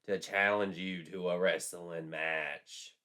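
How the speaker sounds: slow, eerie possessed voice; possessed spirit voice. A narrator's reading. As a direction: speak as a spirit through a borrowed body. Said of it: "I am the spirit of Kate Bates".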